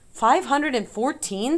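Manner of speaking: high, loud, slow incredulous echo; amazed, rising exclamation; astonished. A narrator's reading. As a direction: repeat the number in utter disbelief. Wilbur amazed at the number.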